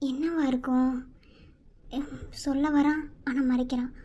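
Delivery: low, soft thinking aloud; inner thoughts. Acted. Wife wondering what her husband hides, low and soft.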